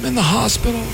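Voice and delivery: a lightly put-on voice of a man in trouble; faked hospital call. Prank call, faking an emergency.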